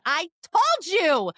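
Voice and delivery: high, slow smug reply; smug told-you-so. Retold or imitated. Answering fans on tour, a slow high smug tease. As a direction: drag it out knowingly. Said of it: "And I said".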